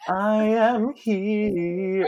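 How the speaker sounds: slow, high, drawn-out diva line; mock dramatic diva. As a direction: hold each word like a diva. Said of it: "like he's quoting [name]".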